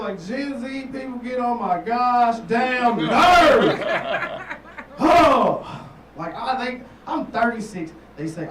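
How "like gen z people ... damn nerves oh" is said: loud, high, slow laughing rant. Real, not acted. Comic ranting to the room about Gen Z, loud mock fury. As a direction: vent it with comic exasperation.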